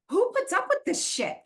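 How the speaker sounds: high, incredulous exasperation; exasperated. Retold or imitated. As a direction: ask it like you can't believe anyone would. Retold exasperation at drunk women.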